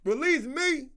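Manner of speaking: high, loud whiny inmate begging to get out; hammed-up prisoner wailing he's vulnerable; mock sick prisoner. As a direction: shout the fake complaint up high like a joking prisoner. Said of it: "Prisoners are probably making jokes".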